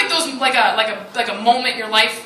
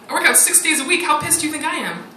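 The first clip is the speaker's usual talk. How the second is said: normal talking voice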